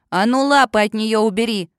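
loud threatening snarl; menacing bark. A narrator's reading. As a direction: bark the order at him. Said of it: "грозно рявкнула она". (грозный рявк)

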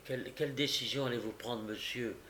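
hushed, quick question; subdued clerk's murmur; low voice. A narrator's reading. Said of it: "demanda le greffier à voix basse".

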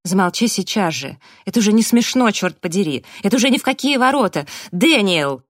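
loud, high outburst, building and speeding up; angry snapping. A narrator's reading. Abby scolding Raf, then appealing to Daniel, building anger. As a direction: build from a sharp order to an exasperated cry. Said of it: "огрызнулась Эбби".

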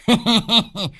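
high falling giggle; high cackle. Acted. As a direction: cackle at his own poem. Laughs after his poem. (cười khanh khách giọng cao)

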